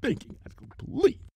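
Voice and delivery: breathy murmur; a secret aside to listeners; whispered off-mic remark. Real, not acted. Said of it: "reader aside whispered".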